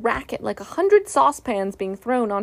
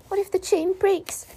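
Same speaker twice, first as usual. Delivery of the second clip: high anxious voice; high frightened murmur; fearful Piglet; 9 semitones higher than usual. A narrator's reading. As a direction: worry aloud to yourself. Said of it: "he whispered to himself".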